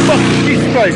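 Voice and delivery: high, loud jolt of fright; a loud yelp as the fear hits; real startled yell. Real, not acted. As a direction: caught off guard by a passing truck. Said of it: "that scared the shit out of me".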